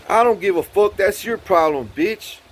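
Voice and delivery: high, loud dismissive jeer; confrontational retort. Retold or imitated. Neighbor brushing off his troubles, confrontational. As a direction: imitate an angry, dismissive neighbor.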